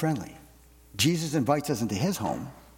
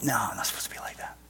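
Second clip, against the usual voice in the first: whisper; 3 semitones lower, 6 dB quieter, faster than usual. Retold or imitated. Inner self-correction, whispered.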